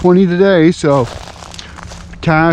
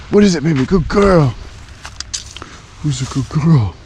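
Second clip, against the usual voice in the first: pet talk. Real, not acted. Talking sweetly to the dog.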